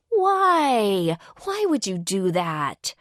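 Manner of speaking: high, loud whining why; a high complaining girl's voice; whiny disappointment. A narrator's reading. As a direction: wail at the loss like a let-down girl. Kaylee disappointed whine.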